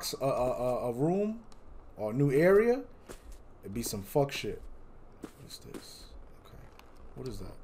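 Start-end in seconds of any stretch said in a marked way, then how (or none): 5.2-7.6 hushed, breathy unease; scared whisper. Real, not acted. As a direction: whisper it as if something might hear. Creeping through a dark room.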